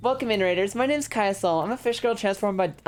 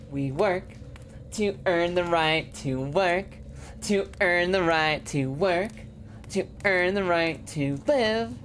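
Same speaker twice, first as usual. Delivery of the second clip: slow solemn creed; slower than usual. Acted. Reading solemn game creed.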